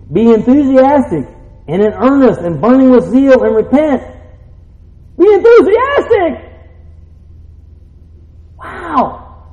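high, loud exhortation; full-force preaching. Real, not acted. Preacher asking if they are enthusiastic about the Lord, fired up. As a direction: rouse the congregation, voice soaring.

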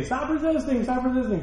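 high-pitched repeated order, not truly yelled; vocal police command. Shown as a demonstration. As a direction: demonstrate an officer's public "stop resisting" call. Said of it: "you have to be very vocal".

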